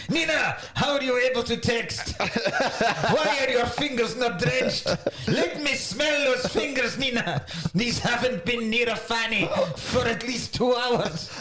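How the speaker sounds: high, indignant attempt at a French accent; hammed-up French ballet director demanding answers; Vincent Cassell. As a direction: interrogate her in rough French-accented English. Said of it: "I think I can either".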